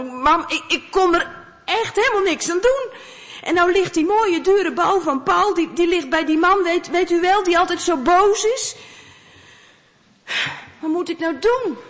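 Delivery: put-on high, upset child's voice; pleading child. Boy pleading with his mother after losing the ball, high and upset. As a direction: plead with mum, close to tears.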